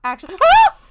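sudden high shriek of fright; startled scream. Real, not acted. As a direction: scream as the monster appears. Scare in horror game.

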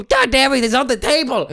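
ordinary delivery

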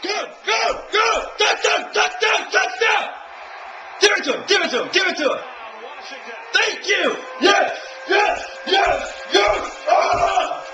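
screaming high and loud at the TV; real fan yelling at a touchdown; excited cheering. Real, not acted. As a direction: ecstatic shouted chant.

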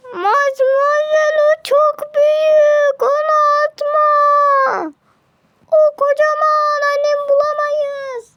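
high, loud, frantic protest; a squeaky servant shrieking in alarm; panicked high servant. Acted. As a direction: beg her not to throw it, in a high shrill panic. Panicked servant stopping her.

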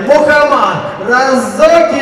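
announcer hype. Real, not acted. Ring announcer introduces fighter.

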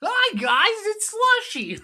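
very high creepy voice ending in a laugh; creepy high greeting. Acted. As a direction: greet them like a creepy doll.

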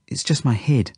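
usual speaking voice

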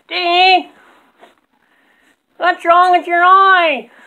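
high squeaky puppet voice; squeaky ventriloquist dummy. Acted. Ventriloquist dummy talking to Dave.

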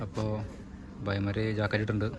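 ordinary talk, nothing marked

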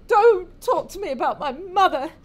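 acted tearful protest, high and loud; sobbing. Grieving wife to her husband about her mother, sobbing. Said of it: "starts sobbing and crying".

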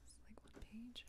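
barely voiced murmur; whispering to self. Real, not acted. Streamer muttering to herself over her script, whispered. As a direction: whisper it under your breath.

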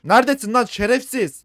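loud high mock yell; mock angry yell. Dubbing the game father hunting for his son, mock yell. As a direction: bellow it like a furious dad. Voicing father yelling for kid.

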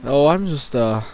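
low innocent visitor voice. Pretend. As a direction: play it off low and quiet. Role-playing a hospital visitor.

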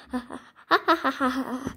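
staccato "ha ha ha"; acted laughter. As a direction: laugh helplessly from laughing gas. Hit by laughing gas.

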